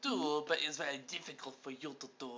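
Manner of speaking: mock-French lilt, trailing to a hush; put-on French accent. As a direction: tease in a showy French accent. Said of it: "I'm the one who does accents".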